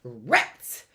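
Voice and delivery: mad rats. Shown as a demonstration. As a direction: grumble it like a foiled plan. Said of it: "Or mad".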